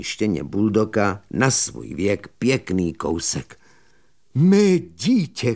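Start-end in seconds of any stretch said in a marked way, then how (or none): 4.3-5.5 pomalu a výš, zděšeně vykřikne; vykřikni s vytřeštěnýma očima; zděšený výkřik. Acted. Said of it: "křičel mladík s vytřeštěnýma očima". (mladík zděšeně křičí nad košíkem)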